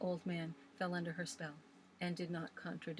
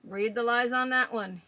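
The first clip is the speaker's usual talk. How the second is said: put-on old Swales ranting; 5 semitones higher, 12 dB louder than usual. A narrator's reading. Old man scoffing at legends to the young ladies, loud and gruff. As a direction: rail against ghost stories like a stubborn old sailor.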